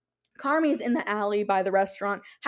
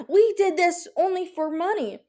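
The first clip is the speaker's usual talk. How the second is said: mocking studio exec; 7 semitones higher than usual. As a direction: sneer at the money-grab reboots. Repeats mock line, laughing.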